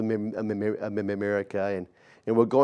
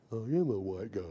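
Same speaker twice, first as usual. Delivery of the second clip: much softer, dry retort; deadpan quiet reply; 3 semitones lower, 11 dB quieter, faster than usual. Retold or imitated. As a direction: mutter it flatly back. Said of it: "I'm like".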